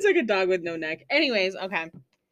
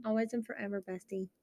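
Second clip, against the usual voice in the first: cheesy sweet; 9 dB quieter than usual. Acted. Cheesy friendship moment.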